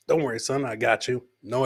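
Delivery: ordinary talk, nothing marked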